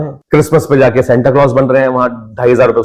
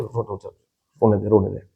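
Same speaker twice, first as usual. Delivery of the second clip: much softer, gently letting him cry; gentle father; 6 semitones lower, 10 dB quieter than usual. Retold or imitated. Father on a video call lets his crying son weep, very softly.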